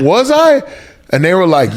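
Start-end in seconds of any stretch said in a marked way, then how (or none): 0.0-0.6 very high, incredulous question; mock disbelief. Husband caught out by wife and son, high mock disbelief. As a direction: squeak it out in comic surprise.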